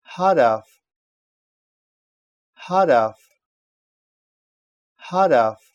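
Хараф ending on a voiceless f; final в as ф. Shown as a demonstration. Teacher models word-final в for learners, Хараф ending in ф. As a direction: close Хараф with ф in place of в. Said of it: "произносится".

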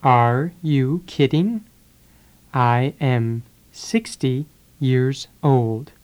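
normal talking voice

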